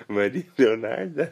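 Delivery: laughing disbelief, slow and loud. Real, not acted. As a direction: laugh through the name.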